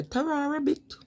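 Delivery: high-pitched Wolverhampton farewell; Wolverhampton accent. Shown as a demonstration. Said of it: "they say".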